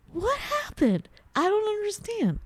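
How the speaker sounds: high, bewildered "What happened?"; baffled high voice. Retold or imitated. To her husband, mimicking her own confused question, high and baffled. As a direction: squeak it out, totally lost. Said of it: "I'm like".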